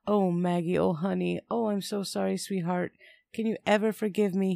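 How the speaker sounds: high, pleading, tearful apology; narrated sobbing. A narrator's reading. Man hugging child he scared, tearful pleading. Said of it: "he sobbed".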